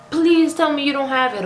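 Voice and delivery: high, pleading impression of grandma; high-pitched grandma. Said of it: "her high-pitched self".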